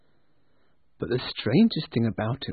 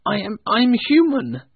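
put-on higher, louder protest of a frightened old man; arguing; 9 semitones higher, 6 dB louder than usual. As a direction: argue back in alarm. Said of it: "Scrooge argued".

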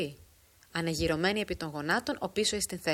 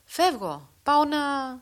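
loud, high call into the distance; calling from afar; 8 semitones higher, 6 dB louder, slower than usual. A narrator's reading. Frangogianou calling back to Giannis from afar, loud call. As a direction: shout back over the distance. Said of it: "φώναξε μακρόθεν".

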